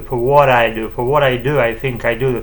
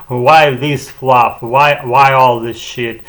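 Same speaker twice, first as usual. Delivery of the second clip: mock old English grumble, louder and slow; harsh older Englishman accent; 5 dB louder, slower than usual. Imitating people who complain about his rambling, in a harsh older Englishman accent. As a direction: grouse like an old English dude. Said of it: "with all this harsh accent, uh, like an older Englishman".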